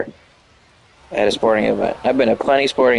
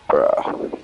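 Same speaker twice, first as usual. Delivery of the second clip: ordinary delivery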